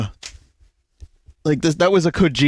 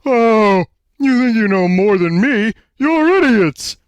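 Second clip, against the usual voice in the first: higher, louder furious Homer shout; Homer yell; 9 semitones higher, 4 dB louder than usual. Acted. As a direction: yell it like an angry cartoon dad. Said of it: "Homer, furious, yells".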